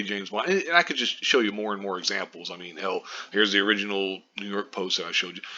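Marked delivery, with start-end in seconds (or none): none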